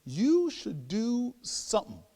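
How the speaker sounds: slow, high, grand revelation; mock proud. Elder woman finally delivering her advice, proud and portentous. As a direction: stretch each word like great wisdom. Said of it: "she looks at me proudly".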